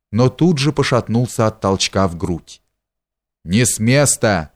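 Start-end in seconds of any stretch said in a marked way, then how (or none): none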